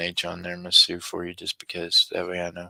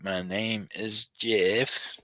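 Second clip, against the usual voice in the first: "My name is Jeff" drawn out slowly, rising at "Jeff"; slow mock quote; much slower than usual. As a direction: stretch the line out like a goofy quote.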